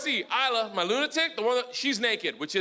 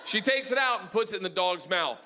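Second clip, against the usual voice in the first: ordinary talk, nothing marked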